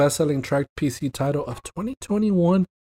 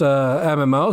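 normal talking voice

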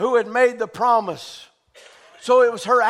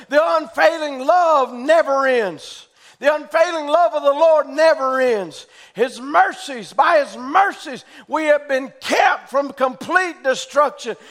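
fervent preaching; 5 semitones higher, 4 dB louder than usual. Acted. Preaching Jeremiah's words.

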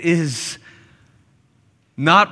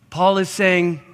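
ordinary delivery

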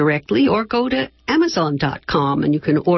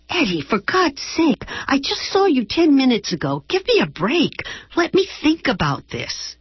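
much higher, pleading exasperation; exasperated Lorna; 9 semitones higher than usual. Acted. Lorna pleading with Eddie on the phone, exasperated. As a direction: beg for a break, voice pitched way up. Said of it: "Lorna exasperated with Eddie on phone".

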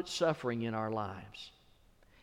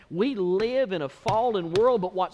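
higher, louder and slow; preaching climb; 8 semitones higher, 10 dB louder than usual. Real, not acted. Pastor addressing brothers and sisters, loud and slow. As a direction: lift it to a big finish.

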